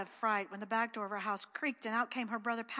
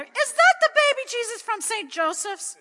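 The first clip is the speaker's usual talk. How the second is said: high, loud disbelieving question; a mother who can't believe her eyes; higher, louder shocked question; astonished mother; 13 semitones higher, 13 dB louder than usual. A narrator's reading.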